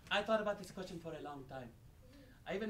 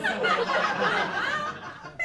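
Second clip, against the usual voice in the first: shrieking laugh; 11 dB louder than usual. Acted.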